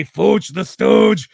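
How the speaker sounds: gruff wrestler promo growl; deep gravelly voice. Retold or imitated. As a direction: growl it like a tough eighties wrestler. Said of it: "Axe had a deep, gravelly voice like this".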